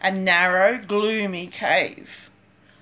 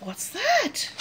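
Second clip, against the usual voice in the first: scared whisper; 10 semitones higher, 4 dB quieter than usual. Acted. Hearing something in cave.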